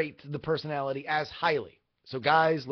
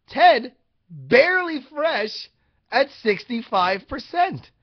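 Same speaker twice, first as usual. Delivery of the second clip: excited; 9 semitones higher, 8 dB louder, slower than usual. Real, not acted. Excited score reveal.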